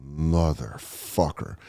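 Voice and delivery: low, slow curse under the breath; soft, drawn-out seething aside; muttered curse. Retold or imitated. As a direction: mutter it slowly to yourself. Spots the non-tippers across bar.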